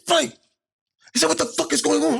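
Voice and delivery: high-pitched, worked-up impression of an agitated associate; Dean. Associate runs up demanding what's happening, agitated. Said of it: "Sound like Dean, too".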